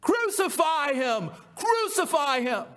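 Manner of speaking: high, slow, insistent chant of the mob; crowd crying out. Acted. Chief priests cry out to Pilate, high and chanting. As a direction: call for crucifixion like an angry crowd. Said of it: "they cried out".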